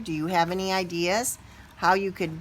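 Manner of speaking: usual speaking voice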